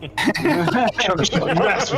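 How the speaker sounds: high, drawn-out laughing protest; laughing outburst. Real, not acted. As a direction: curse them through helpless laughter. Laughing over a teasing counterspell.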